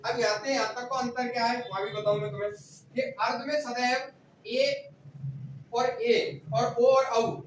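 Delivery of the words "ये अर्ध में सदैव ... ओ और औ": ए, ओ, औ spelled out slowly, word by word; slow dictation. Shown as a demonstration. Teacher dictates the vowels slowly so students note them down. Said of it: "इतना याद रखियो".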